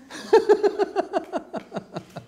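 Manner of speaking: high bright burst of laughter. Real, not acted. Laughing at the guru's witty line, a real high laugh.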